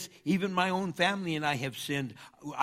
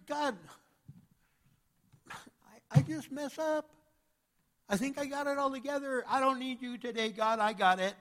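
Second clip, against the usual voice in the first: smug mock prayer; 9 semitones higher than usual. Mocking a self-satisfied prayer.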